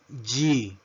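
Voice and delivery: letter G pronounced "jee"; English letter G. Shown as a demonstration. Teacher modelling the English alphabet, G as "jee". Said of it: "La letra G".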